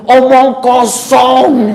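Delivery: put-on slow, high, drawn-out child's blurt; a cheeky kid blurting it out slowly in the pause; child's blunt remark. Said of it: "Itu dia ngomong di depan majelis tuh".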